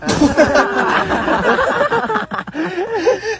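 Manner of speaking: high squawky cartoon duck cackle; a hammed-up high laugh like a cartoon duck; Daffy Duck laugh. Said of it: "Para kang si Daffy Duck".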